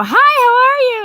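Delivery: very high, loud, gushing hi; mock excited greeting. Husband greeting wife excitedly, very high and loud. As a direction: gush it way up high. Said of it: "all excited".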